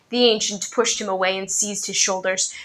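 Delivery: ordinary delivery, nothing marked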